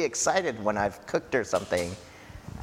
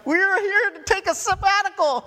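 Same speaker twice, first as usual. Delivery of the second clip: much higher, louder, squeaky mock complaint; mock whiny disciples; 6 dB louder than usual. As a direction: play the worn-out disciples, whining in falsetto. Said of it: "and they're like".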